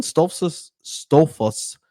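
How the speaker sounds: "Stoltzfus" slurred as STOLF-sus, then STOH-fus; fumbled surname attempts. Real, not acted. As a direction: stumble over "Stoltzfus", losing the middle T.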